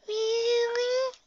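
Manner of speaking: very high, awed question; amazed filly. Acted. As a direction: sound thrilled and amazed.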